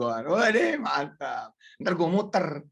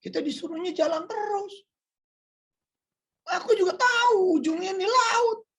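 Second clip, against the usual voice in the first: high, rising, ever louder plea; Moses answering; 5 dB louder than usual. Acted. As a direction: Moses pleads, building to a cry. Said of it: "kata Nabi Musa".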